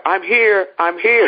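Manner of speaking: high-pitched retold call. Winner calls from the back of the room, calling out.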